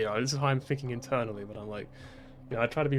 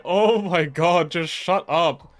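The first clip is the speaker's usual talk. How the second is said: slow, much louder fed-up plea; inner exasperation; 3 semitones higher, 9 dB louder, much slower than usual. Retold or imitated. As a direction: stretch it out like you're at the end of your rope. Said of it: "this is what I'm thinking to myself".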